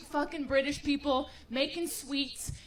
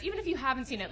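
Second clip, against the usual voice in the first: ordinary talk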